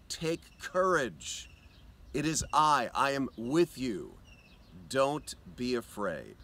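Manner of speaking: slow, gentle words of comfort; tender reassurance. Acted. Jesus speaking to the listener.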